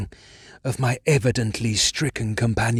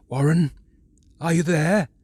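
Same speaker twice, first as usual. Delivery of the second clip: calling down; 5 semitones higher, 4 dB louder than usual. A narrator's reading. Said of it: "Again I called down".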